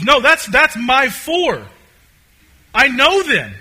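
loud, high, drawn-out insistence; emphatic shepherd. Retold or imitated. Shepherd insists on his sheep.

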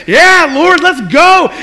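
a prophet ready to charge in; eager. Retold or imitated. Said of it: "And he's like".

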